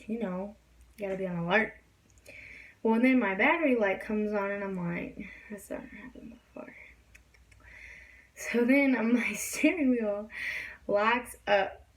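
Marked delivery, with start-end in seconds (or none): 5.5-6.7 breathy whisper of dawning worry; whispered to herself. Retold or imitated. Driver alone on the highway whispering to herself. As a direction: whisper it like a private realization. Thinking aloud as warning lights come on.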